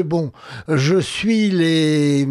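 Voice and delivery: usual speaking voice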